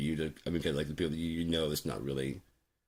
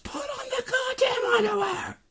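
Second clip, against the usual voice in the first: very high, loud angry shriek; one last screamed order after him; mad wife's last scream; 7 dB louder than usual. Retold or imitated. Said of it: "And there's one last".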